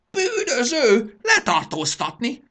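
very high, slow shriek; explosive furious yell; beside himself raging. Acted. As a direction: scream the accusation and order, stretched out. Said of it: "dühöngött magából kikelve".

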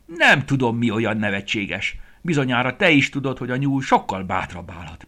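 rabbit snaps loudly at the bear; indignant, loud, high little rabbit; angry snapping. Acted. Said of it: "mérgesen a mackóra rivalt".

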